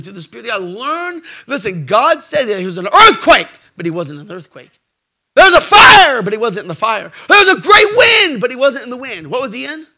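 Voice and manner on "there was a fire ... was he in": shouted, high preacher's build; preaching at full force. Acted. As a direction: thunder out each line.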